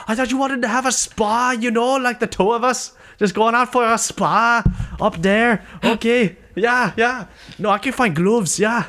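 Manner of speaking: high-pitched, exaggerated mock-Welsh accent; Darren Till impression. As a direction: ramble agreeably in a badly faked high Welsh voice. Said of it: "I can't do a Welsh accent".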